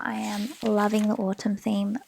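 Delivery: normal talking voice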